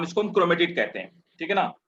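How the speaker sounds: ordinary talk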